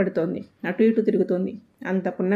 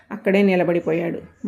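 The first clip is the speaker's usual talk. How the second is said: usual speaking voice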